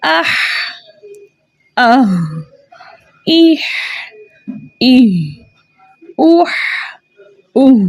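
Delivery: आह इह उह drilled in pairs; letter sounds demo. Shown as a demonstration. Teacher repeating the letter drill for students. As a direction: sound out each syllable carefully. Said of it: "ये है".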